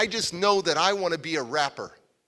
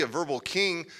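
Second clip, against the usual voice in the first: ordinary delivery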